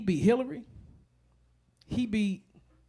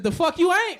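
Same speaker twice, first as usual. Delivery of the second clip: mock retort; 6 semitones higher, 5 dB louder than usual. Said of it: "She said".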